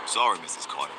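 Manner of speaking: a father apologizing for his sons, dignified; deep dad apology. Retold or imitated. Dad apologizing to the neighbour, deep and grave. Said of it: "So my dad goes".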